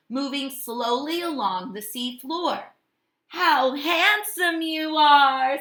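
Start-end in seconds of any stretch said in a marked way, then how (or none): 3.3-5.6 slow, raised praise; admiring Hermit Crab. A narrator's reading. Crab praising the starfish, slow and raised. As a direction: admire them slowly with delight. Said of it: "said Hermit Crab".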